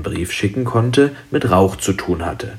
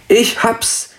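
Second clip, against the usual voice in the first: high, sudden cry of an idea; plötzlicher Ausruf; 12 semitones higher, 3 dB louder than usual. A narrator's reading. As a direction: burst out with the solution. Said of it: "rief Mathilda plötzlich". (hoch und plötzlich ausgerufen)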